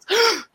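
sharp acted gasp; frightened gasp. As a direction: suck in air in alarm. Blind mouse reacts to threat.

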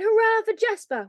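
high-pitched hurrah; echoed cheer. A narrator's reading. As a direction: take up the cheer excitedly. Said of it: "went all around the room".

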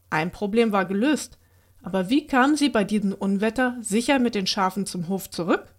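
plain reading voice

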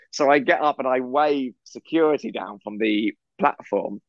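ordinary talk

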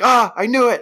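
high, loud yelp of alarm; retold panic. Retelling Buckley spotting the hazmat suit, panicked. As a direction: burst out in mock panic.

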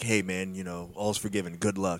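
mock quiet farewell. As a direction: murmur it like a hollow farewell. Mock quote of the band.

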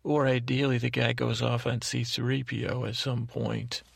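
ordinary delivery

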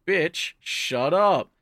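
high, very slow, drawn-out put-down; mock contempt; dismissive. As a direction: stretch out the brush-off. [name] dismisses the sacrifice.

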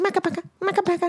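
high, fast chanted character voice; squeaky, fast little-creature chant; kids' character voice. Retold or imitated. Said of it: "and he was going".

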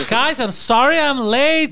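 high, slow camp apology; over the top, flamboyant. Acted. Flamboyant biker arrives late to the gang, theatrical. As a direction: sweep in gushing and stagey. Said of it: "If he acts like that, over the top, flamboyant".

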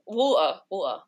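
"water" said as wo'uh, no T; dropped T. Shown as a demonstration. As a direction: swallow the T in "water". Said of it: "they drop the T".

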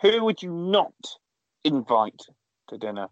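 ordinary delivery

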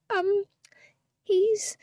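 high, hesitant stall; stalling, unsure. Real, not acted. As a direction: caught out, drag the um. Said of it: "I stalled".

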